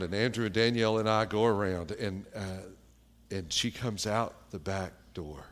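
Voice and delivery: ordinary talk, nothing marked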